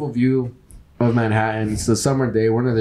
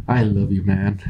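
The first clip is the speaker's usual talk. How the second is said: creepy; 6 semitones lower than usual. Retold or imitated. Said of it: "he said it all creepy".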